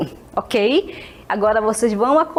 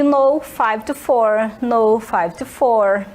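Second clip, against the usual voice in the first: slow, careful repeated reply; slow clear answer; much slower than usual. Shown as a demonstration. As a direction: model the reply slowly. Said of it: "ela responde". (resposta lenta e clara)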